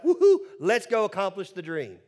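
loud whooping cheer; mock excitement. Preacher imagines Moses raring to go, mock-excited. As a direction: cheer like an over-eager recruit.

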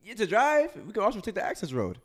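mocking retort. Real, not acted. Host sarcastically arguing with his co-host about highways. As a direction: toss it back sarcastically.

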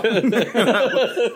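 short high laugh; real laugh. Real, not acted. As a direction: chuckle it out.

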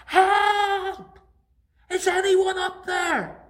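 high desperate shout; crying out for help. Acted. Man hanging from a branch shouts up, desperate. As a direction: yell up the cliff in panic. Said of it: "He cried out".

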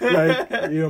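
laughing while telling it; giggling through the words. Real, not acted. Laughing recalling held laugh.

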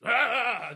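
sudden high yelping shriek, half laughing; a laughing imitation of a child screaming nonstop; mock kid scream. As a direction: squeal like a screaming toddler. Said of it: "just fucking screaming all the time".

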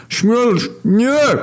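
very high, strained cry; anguished shout. Acted. Hunger seeing his brother charge, desperate high shout. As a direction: cry out high, stretching both words. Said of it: "krzyknął tylko głód".

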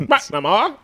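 mock British, up high and rising; high British accent. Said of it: "That is high".

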